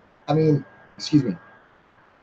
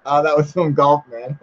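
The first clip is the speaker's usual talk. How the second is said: ordinary delivery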